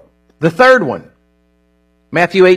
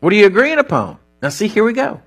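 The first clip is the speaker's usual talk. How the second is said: ordinary delivery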